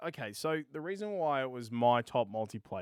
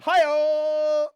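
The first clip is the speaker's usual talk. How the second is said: comic hi-oh; 12 semitones higher, 12 dB louder than usual. Pretend. Cheeky innuendo callout.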